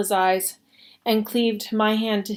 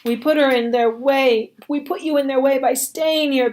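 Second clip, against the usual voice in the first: a raised, anguished lament, louder at first; grieving cry; 5 semitones higher, 5 dB louder than usual. Acted. Pink crying out as he rocks the dying Momo Bay. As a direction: cry out over the dying woman. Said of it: "Pink cried".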